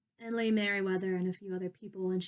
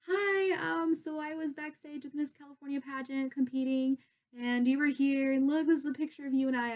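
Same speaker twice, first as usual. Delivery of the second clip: higher-pitched, gushing re-enactment; eager fan greeting; 4 semitones higher, 3 dB louder than usual. Retold or imitated. Fan showing pageant winner an old picture, eager. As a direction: rush up brightly, rambling to an idol.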